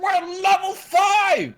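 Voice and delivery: high, slow, thrilled cry; excited. Real, not acted. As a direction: shout it with glee. Party levels up.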